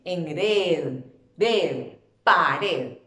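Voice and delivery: single out "red", then hit it in "pared"; stress on last syllable. Shown as a demonstration. Teacher answering where the stress falls, "red" stressed. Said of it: "dónde hago mi fuerza de voz".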